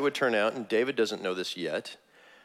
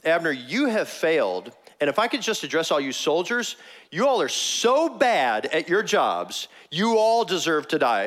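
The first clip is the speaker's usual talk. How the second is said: acted rebuke; 5 semitones higher, 4 dB louder than usual. David berating Abner and his soldiers, emphatic rebuke. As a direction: publicly scold them, stretching the key words. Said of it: "the idea is he's saying".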